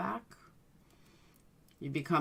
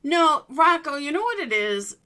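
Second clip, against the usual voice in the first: excited; 11 semitones higher, 6 dB louder than usual. Real, not acted. Eager to disagree in group.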